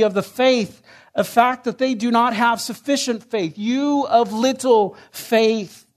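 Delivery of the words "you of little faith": slow solemn quote. Acted. Repeating the Lord's indictment.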